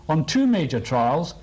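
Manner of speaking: ordinary delivery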